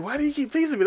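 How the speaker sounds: high-pitched imitation of a teased boy; mock plaintive complaint. Boy asking why she teases him, plaintive imitation.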